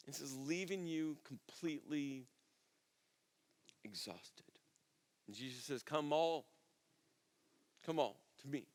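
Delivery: soft, low, unhurried invitation; tender preaching. Real, not acted. Preacher offers the weary Jesus's gift of rest, tenderly. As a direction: offer it gently, leaving room between phrases.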